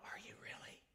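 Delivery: put-on hushed, wide-eyed kid's whisper; imitated child's whisper; awed whisper. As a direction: whisper it like a secret. Kid whispers doubting.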